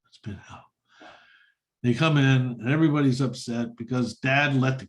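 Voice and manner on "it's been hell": whispered, drained repeat of the same words. Retold or imitated. Relieved dad to neighbor, whispering weakly. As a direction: breathe it out like an exhausted father. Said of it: "He goes".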